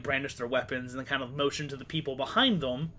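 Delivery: ordinary talk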